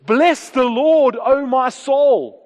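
ringing, drawn-out scriptural command; commanding declaration. Retold or imitated. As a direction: order your own soul to praise. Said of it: "I command you, soul, is the language".

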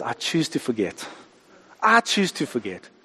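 soft, hushed delivery; gentle divine voice. Acted. God answers a repentant sinner, gently and softly. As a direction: say it softly and simply, letting it sink in.

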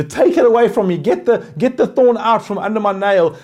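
whining plea. Pretend. Voicing a complaining believer.

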